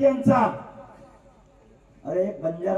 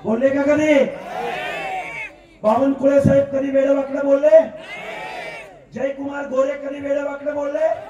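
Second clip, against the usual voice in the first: rally shout; 5 semitones higher than usual. Real, not acted. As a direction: hurl the questions at the crowd. Rally speech rhetorical questions. (जोरात विचारलेले प्रश्न)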